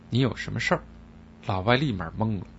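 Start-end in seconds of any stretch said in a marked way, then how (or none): none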